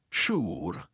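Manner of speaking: low deep resonant voice. A narrator's reading. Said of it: "said in a deep, resonant voice".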